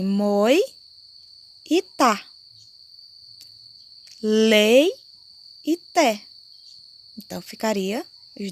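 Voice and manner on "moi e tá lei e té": "moi" and "tá", then "Lei" and "té", each syllable set apart and louder; syllables split. Shown as a demonstration. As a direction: split "moita" and "leite" into syllables, one beat each. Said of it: "Se eu for separar, ficaria assim". (separando as sílabas, "moi e tá", "Lei e té")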